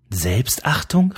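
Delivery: drawn-out, rising echo in disbelief; stretched indignant query; incredulous exclamation. A narrator's reading. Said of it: "rief sie".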